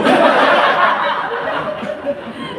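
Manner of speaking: very high airy cackle with no voice; long high airy cackle; high breathy laugh. Real, not acted. Laughs at his own joke.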